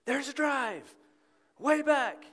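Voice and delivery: high, loud rising play-by-play call; announcer call. Retold or imitated. Preacher imitating Harry calling a long fly ball. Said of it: "He would say".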